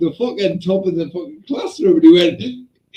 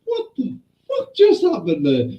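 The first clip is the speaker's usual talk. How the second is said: high-pitched retold disbelief; stunned teacher; 8 semitones higher than usual. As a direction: imitate a teacher aghast, pitched up. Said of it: "Even our teacher went there going".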